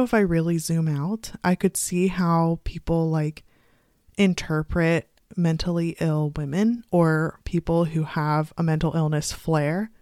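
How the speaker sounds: usual speaking voice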